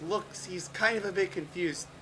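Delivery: ordinary talk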